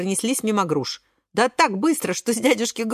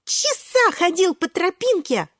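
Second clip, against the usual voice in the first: high, drawn-out, emphatic boast; worked up; 9 semitones higher, slower than usual. A narrator's reading. Шпинатик telling of his two-hour test, high and emphatic. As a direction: stretch each word in high-pitched excitement. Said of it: "подскакивает Шпинатик".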